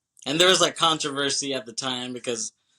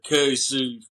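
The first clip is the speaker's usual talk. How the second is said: cheesy imitation Southern accent, drawn out and louder; mock Southern accent; 5 dB louder than usual. Movie podcaster mocking the actor's cheesy accent, mock Southern accent. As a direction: put on a hammed-up Southern accent. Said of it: "he has this cheesy-ass accent".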